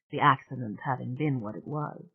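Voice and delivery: ordinary delivery, nothing marked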